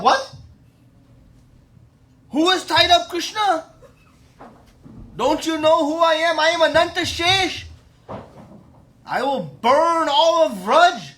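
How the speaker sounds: loud, high-pitched threatening roar; outraged shout. Acted. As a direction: thunder indignantly at the culprits. Outraged Balram threatens in acted story.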